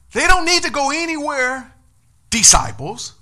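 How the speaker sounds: high, loud shout; preaching shout. Acted. Preacher drives Jesus' words home to the disciples, shouting. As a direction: lift it up and shout it out.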